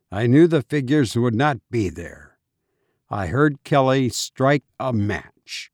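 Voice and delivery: ordinary delivery, nothing marked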